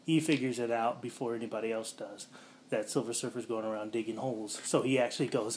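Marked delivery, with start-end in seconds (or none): none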